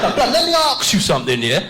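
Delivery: high, swaggering street slang; mock London roadman; roadman kid.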